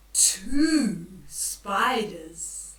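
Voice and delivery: drawn out and loud, very slow; dramatic read-aloud build; slow spooky reveal. Acted. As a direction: stretch two spiders out for suspense.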